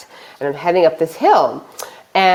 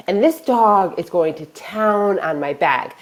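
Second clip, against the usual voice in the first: plain reading voice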